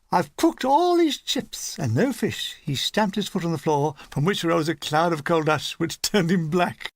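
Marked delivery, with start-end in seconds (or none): 0.1-2.6 high, slow mock woman's voice; female voice. Dean playing a fussing woman to the gunners, high and slow. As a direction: ham up a fussing housewife, higher and slow. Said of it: "said Dean in a female voice".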